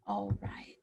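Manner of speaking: breathy whisper, almost silent; a soft whispered All right; whispered aside. Real, not acted. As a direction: drop to a whisper for a moment. Teacher aside between tasks.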